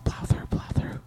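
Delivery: urgent unvoiced whisper. Real, not acted. Urging the host on in a whisper so others won't hear. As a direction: hiss encouragement under your breath.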